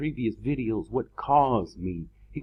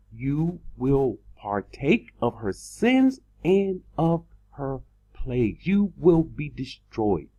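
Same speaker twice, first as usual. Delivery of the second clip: slowly; slower than usual. Shown as a demonstration. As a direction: spell it out word by word. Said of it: "So let me take it slowly".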